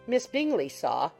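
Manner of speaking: usual speaking voice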